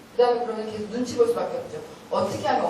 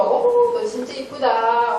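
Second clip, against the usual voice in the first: gushing visitor; 6 dB louder, much slower than usual. Retold or imitated. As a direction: gush over the baby like a charmed guest. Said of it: "사람들도 진심으로 얘기하는 거예요".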